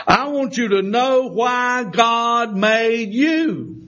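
full-force preaching. Acted. Preacher telling the church God made them, soaring. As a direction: lift the room, stretching each phrase. Preaching climax about God's purpose.

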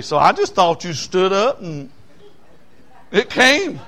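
loud, high, building preacher's cry; fervent preaching. Acted. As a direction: lift the congregation with rising fervour. Preaching with rising force.

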